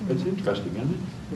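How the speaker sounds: ordinary talk, nothing marked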